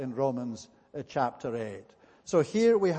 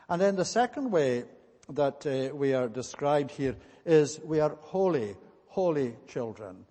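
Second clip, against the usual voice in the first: ordinary talk, nothing marked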